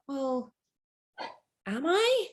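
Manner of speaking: hesitant, then a high questioning rise; pause, then query it with rising pitch; doubtful. Retold or imitated. Answering someone who calls you an influencer, unsure of yourself.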